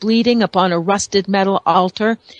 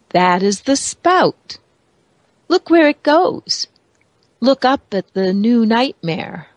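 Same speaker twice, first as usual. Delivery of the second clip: oily blackmailer purr; 3 semitones higher than usual. A narrator's reading. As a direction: savor each word like a temptress. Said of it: "her oily voice rumbling through the water".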